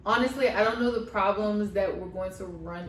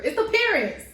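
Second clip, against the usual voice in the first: higher, louder punchline on parents; emphatic exclamation; 7 semitones higher, 5 dB louder than usual. Real, not acted. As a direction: land it like a gotcha. Mocking everyone's parents in show.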